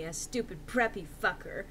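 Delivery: plain reading voice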